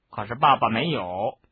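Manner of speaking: a touch of gloom, pitched slightly down; mildly downcast reply; gloomy. A narrator's reading. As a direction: say it glumly, understated. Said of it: "弗雷德忧郁地说".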